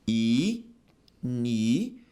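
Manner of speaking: "Inicial" stretched very slowly, each syllable apart: i-ni-ci-al; syllable-split word. Shown as a demonstration. Teacher slowly dividing a word into syllables for students. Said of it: "Como é que eu separo o inicial?".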